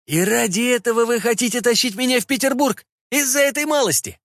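high-pitched indignant question; indignant protest. A narrator's reading. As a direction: protest in disbelief, pitched well up. Prisoner protests at being taken away.

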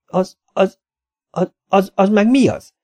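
high, stuttering panic; frightened man stammering at a monster; manic stammer. A narrator's reading. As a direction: stammer it out in horror. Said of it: "kérdezte mániákusan".

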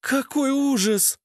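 high, slow cry of horror; horrified woman. Acted. Lena bursts into the hall horrified, high-pitched dismay. Shocked woman enters the scene.

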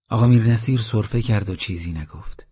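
usual speaking voice

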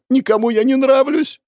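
high, squeaky whining complaint; a very high, petulant girl's voice close to tears; whiny girl. A narrator's reading. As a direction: pout and whine like a sulking child. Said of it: "Понурилась она".

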